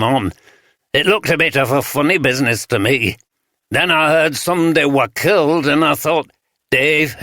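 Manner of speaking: a light broad Yorkshire voice for a wary rough sleeper; broad Yorkshire accent. Acted. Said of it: "spoke in the broad Yorkshire accent".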